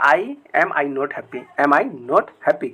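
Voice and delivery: each English word spoken slowly and clearly; slow dictation. Shown as a demonstration. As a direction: dictate it word by word so students can follow. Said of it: "तो क्या बोलना है". (धीरे-धीरे साफ़ बोलना)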